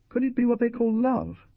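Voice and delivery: overwhelmed exclamation; exclaimed. A narrator's reading. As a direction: burst out in disbelief. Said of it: "she exclaimed after a moment".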